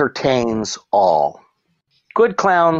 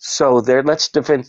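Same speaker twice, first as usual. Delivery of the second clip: ordinary talk, nothing marked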